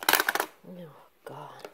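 a soft whispered groan under her breath; whispered to herself. Real, not acted. Carrying everything one-handed, whispering under breath. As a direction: whisper it to yourself while straining.

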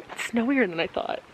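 hushed late-night voice; whispering. Real, not acted. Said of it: "Why are you whispering?".

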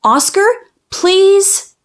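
pleading. Acted. Child begs dog to talk.

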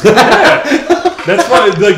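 laughing high and loud. Real, not acted. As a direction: burst out laughing as you answer. Laughing at friend's confusion.

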